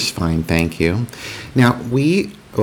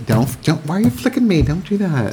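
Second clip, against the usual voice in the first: squeaky high complaint; high-pitched protest; 8 semitones higher than usual. Real, not acted. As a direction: yelp it out high. Being flicked, protesting.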